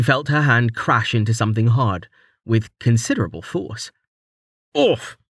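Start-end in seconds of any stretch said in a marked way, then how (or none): 4.7-5.2 startled, sharp cry; pained yelp. A narrator's reading. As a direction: yelp as you're hit. Struck hard, falls.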